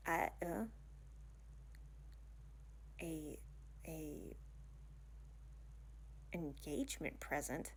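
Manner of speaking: soft, halting disbelief; hushed and hesitant, caught off guard; stunned. Acted. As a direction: falter quietly over the offer. Stunned by sudden engagement present.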